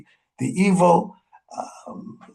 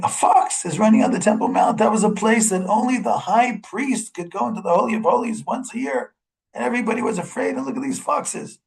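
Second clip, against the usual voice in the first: higher-pitched mock lament, no real sobs; retold tearful lament; 7 semitones higher than usual. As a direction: retell their dismay in a raised voice. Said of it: "all of them are crying".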